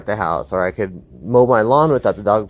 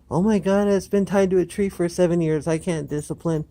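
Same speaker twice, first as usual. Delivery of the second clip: high, pitying mock owner voice; a hammed-up worried dog owner; fretful rescue owner; 8 semitones higher, 4 dB quieter than usual. Mocking an anxious rescue owner who won't discipline.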